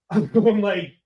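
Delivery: ordinary talk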